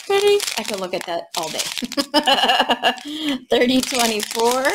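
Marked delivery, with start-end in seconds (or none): 0.1-0.4 delighted squeak. Real, not acted. Gasping at beautiful drills.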